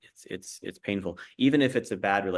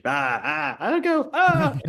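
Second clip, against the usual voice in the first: mock flustered yelps, much higher and louder; flustered squeaks; 10 semitones higher, 6 dB louder, slower than usual. Host imitating Merrily caught out by Lily, high flustered squeaks. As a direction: stammer it out high, caught off guard, playing it up.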